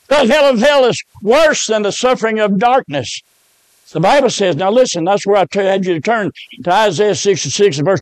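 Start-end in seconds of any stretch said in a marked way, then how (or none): none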